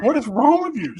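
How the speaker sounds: high baffled question. Retold or imitated. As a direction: ask in high-pitched disbelief. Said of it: "I'm like".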